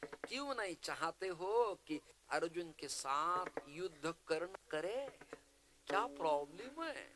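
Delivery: placating nephew-minded schemer, high wheedling plea; Shakuni. Acted. Shakuni begs grandsire Bhishma to hear him out, high placating plea. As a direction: plead with the elder in a high, swooping voice. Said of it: "आंधळ्या धृतराष्ट्राचा आणि शकुनीचा".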